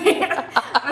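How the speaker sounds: short embarrassed giggle. Real, not acted. Reader laughing at her own slip, quick giggle. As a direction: let a laugh slip out mid-poem.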